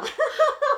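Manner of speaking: 高亢的尖声大笑; 忍不住尖声笑出来; 尖声爆笑. Real, not acted. 朋友听了爆笑. (听到好笑的看牙经历，尖声爆笑)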